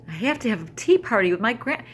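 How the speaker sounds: put-on high, loud childish wail; a little girl overdoing her despair; dramatic little girl. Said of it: "so dramatic".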